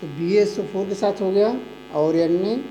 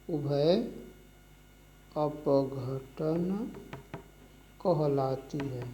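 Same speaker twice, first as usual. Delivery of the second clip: slow dictation; 4 semitones lower, 10 dB quieter, much slower than usual. Shown as a demonstration. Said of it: "teacher dictating slowly".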